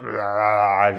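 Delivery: loud, long spewing noise; hammed-up vomit roar; mock retching roar. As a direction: roar out a pretend puke. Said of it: "she spews it".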